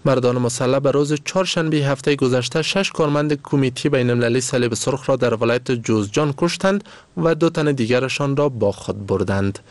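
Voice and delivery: ordinary delivery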